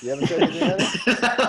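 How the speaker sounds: laughing high and loud. Real, not acted. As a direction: squeal it out through laughter. Teasing friend, laughing.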